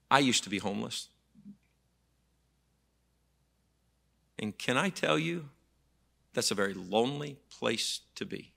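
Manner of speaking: soft voice, with long pauses; quiet retold confession. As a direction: quietly imitate a lady's heartfelt confession. Retold confession of formerly homeless lady.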